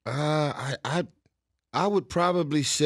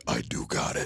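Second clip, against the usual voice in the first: very low, soft mumbled voice; Vin; 10 dB quieter than usual. Retold or imitated. Comedian imitating rich co-star reminded of his money, low soft voice. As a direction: drop way down, quietly. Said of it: "Hey, the reminder".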